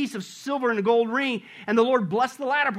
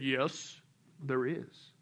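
low, soft voice from heaven; calm, powerful voice; 10 semitones lower, 9 dB quieter, much slower than usual. Acted. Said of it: "this calm, powerful voice".

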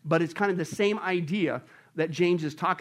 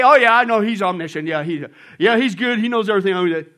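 loud, brushing-off yeah-yeah voice; mock casual agreement; 3 semitones higher, 11 dB louder than usual. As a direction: play someone loudly agreeing without meaning it. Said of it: "Don't just say".

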